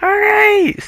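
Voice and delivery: very high, loud, drawn-out squeal; high-pitched voice. Acted. Showing a friend the old school party-trick voice, high-pitched and loud. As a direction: push it right up into a squeaky call. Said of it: "that high-pitched voice".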